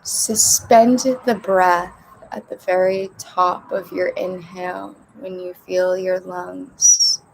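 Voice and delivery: measured meditation voice with long pauses; unhurried breathing instructions, spaced out; guided meditation. Real, not acted. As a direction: guide a group gently through breathing, leaving space between phrases. Leads breathing before guided meditation.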